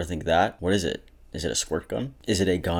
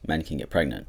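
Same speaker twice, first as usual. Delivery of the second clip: usual speaking voice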